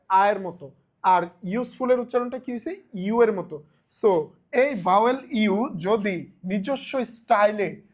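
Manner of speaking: ordinary talk, nothing marked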